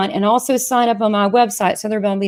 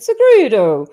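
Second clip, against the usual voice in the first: secreto said louder with a light Italian accent; 6 dB louder, slower than usual. Shown as a demonstration. Host cracking the name by thinking Italian. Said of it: "if I think of the Italian accent".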